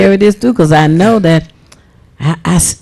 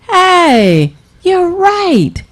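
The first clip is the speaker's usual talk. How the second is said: mock eureka, higher and drawn out; sudden realization; 10 semitones higher, much slower than usual. Enslavers hitting on a better idea, mock delighted realization. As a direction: play a dawning agreement.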